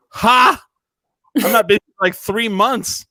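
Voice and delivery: high, loud mock scoff; gleeful scoff. Retold guest scoffing at being asked if he is busy. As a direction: laugh off the question, high and loud.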